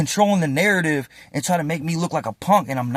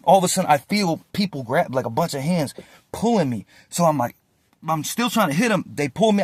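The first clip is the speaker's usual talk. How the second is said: plain reading voice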